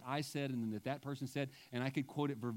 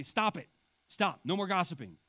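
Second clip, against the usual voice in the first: loud, higher-pitched command; mock scolding order; 5 semitones higher, 7 dB louder than usual. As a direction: lay down the law to the congregation, hammed-up. Acts out scolding the congregation.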